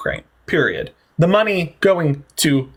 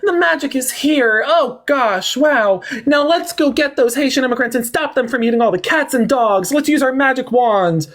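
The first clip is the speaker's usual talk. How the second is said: high, slow, theatrical wonder; mock magician; 8 semitones higher than usual. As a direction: ham it up like a fairy-tale magician.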